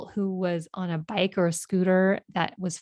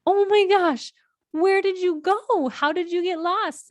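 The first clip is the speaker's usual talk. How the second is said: much higher, acted-out overwrought mother; retold tearful freak-out; 12 semitones higher, 3 dB louder than usual. Mother to her found son, imitated tearful freak-out. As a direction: pitch it way up, as if exclaiming through tears. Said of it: "I'm kind of freaking out. I'm crying".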